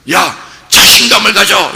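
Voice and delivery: urging mock shout; mock exhortation. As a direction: call it out like the world egging someone on. World urging people, acted quote.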